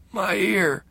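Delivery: high, hoarse pained croak. Acted. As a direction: croak it out in shock. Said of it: "he said hoarsely".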